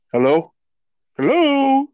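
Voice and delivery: mock call, second hello high and loud with a laugh. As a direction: imitate the voice calling down the line. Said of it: "all you could hear is like".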